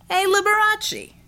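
high, loud, drawn-out taunt; taunting shout. Acted. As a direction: yell it across the fight to mock him.

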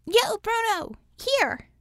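high calling shout across the lot. A narrator's reading. Bella calling Bruno over from the dumpster, high shout. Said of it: "Bella then shouted".

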